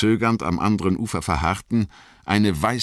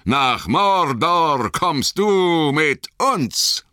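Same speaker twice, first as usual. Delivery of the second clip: high, slow, louder chant with stress on du; icy mocking call; 11 semitones higher, 4 dB louder, much slower than usual. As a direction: taunt him back, slowly. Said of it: "riefen sie".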